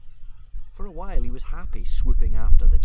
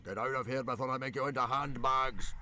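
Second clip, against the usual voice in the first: strained shout, pitched higher; yelled threat; 4 semitones higher, 13 dB quieter than usual. A narrator's reading. Stoic to his hunting dragons, angry yelled threat. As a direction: order them out in a rush of rage. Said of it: "yelled Stoic".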